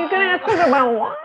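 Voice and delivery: put-on high, laughing whiny-child voice; whiny kid. Co-host mocks a whining child at the start. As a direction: whine it like a sulky kid.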